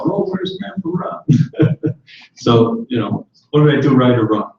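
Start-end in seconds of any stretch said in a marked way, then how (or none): 0.0-1.9 put-on high, slow, breathy child voice; high, slow, unvoiced kid imitation; grandson's voice. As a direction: voice a little boy missing grandpa. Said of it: "he was asking about me".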